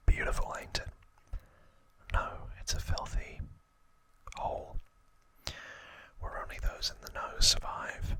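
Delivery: whispered Cockney gangster voice; Cockney accent. Acted. Albert Crisp warning a newcomer to London, whispered Cockney accent. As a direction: put on your best Cockney, all in a whisper. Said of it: "That's my best Cockney accent".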